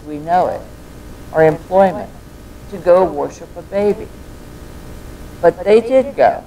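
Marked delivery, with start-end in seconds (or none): none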